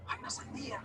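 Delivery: soft unvoiced whisper about the watermelon; whispered news. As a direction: whisper the secret to your brother.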